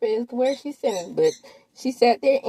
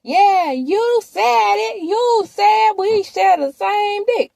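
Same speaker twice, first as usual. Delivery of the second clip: mock-heated yell; 13 semitones higher, 8 dB louder, much slower than usual. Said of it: "And then she says".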